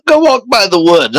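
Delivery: ordinary delivery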